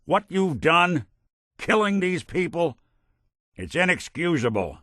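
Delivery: righteous anger, firm and emphatic; indignant condemnation. Acted. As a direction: denounce them, pitch climbing high at the start. Condemning someone for killing people.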